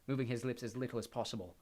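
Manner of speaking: ordinary delivery, nothing marked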